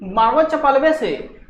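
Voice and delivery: ऊँची डाँट वाली चीख; angry scolding. Acted. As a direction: scold a naughty child, snapping. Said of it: "ऐसे याद करना है".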